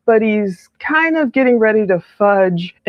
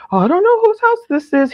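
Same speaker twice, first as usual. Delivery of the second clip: much higher, pleading father's voice; imitating a frantic father, pitched up; distraught father; 10 semitones higher than usual. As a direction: play the upset dad who can't place the house. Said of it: "Jason is distraught".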